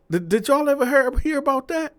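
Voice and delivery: stunned question; incredulous. Real, not acted. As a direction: ask in wide-eyed disbelief. Asking viewers in disbelief.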